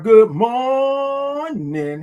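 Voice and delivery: long, high, held MORNING; calling out a long good morning; drawn-out greeting. Real, not acted. Greeting viewers joining the live stream.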